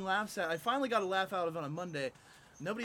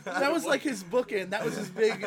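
laughing while talking; 5 semitones higher, 6 dB louder than usual. Real, not acted. Laughing as he retells the callback.

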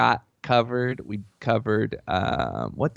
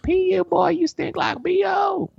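mocking kids; slower than usual. Kids at the door mocking his stink, high disgusted shout. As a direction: squeal it like a grossed-out trick-or-treater. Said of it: "They were like".

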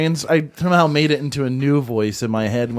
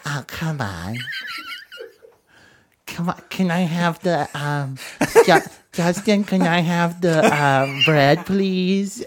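mocking friend's voice; 2 semitones higher, 3 dB quieter, slower than usual. Mocking his friend at dinner with a lightly put-on whiny voice. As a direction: needle him by copying how he talks. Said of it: "you keep talking like this".

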